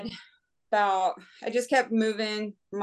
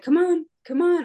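mock urging; 9 semitones higher, 4 dB louder than usual. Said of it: "Steve's like".

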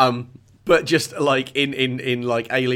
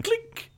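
gun cocking. Retold or imitated. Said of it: "just hears somebody cock a shotgun".

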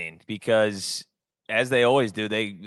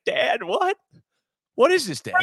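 very high-pitched imitation of a child; mock-bewildered kid voice, pitched way up; shocked son; 14 semitones higher than usual. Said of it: "his son looks at him".

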